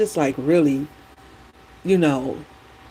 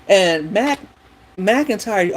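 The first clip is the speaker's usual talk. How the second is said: normal talking voice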